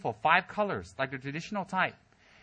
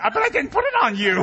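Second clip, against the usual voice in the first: laughing protest; 9 semitones higher, 8 dB louder than usual. Retold or imitated. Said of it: "I'm like".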